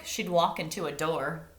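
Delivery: ordinary delivery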